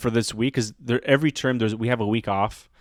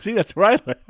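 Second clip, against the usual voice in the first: higher-pitched, laughing through the line; 8 semitones higher, 5 dB louder, much faster than usual. Retold or imitated. Teacher showing students his house, laughing. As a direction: crack up while delivering it. Teller laughs at own joke.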